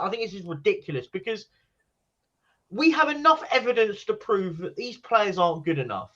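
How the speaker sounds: ordinary delivery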